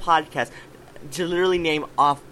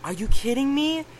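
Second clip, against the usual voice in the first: much higher, stunned "are you kidding me"; stunned disbelief; 10 semitones higher than usual. Retold or imitated. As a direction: disbelief at hearing of the death. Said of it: "And I'm like".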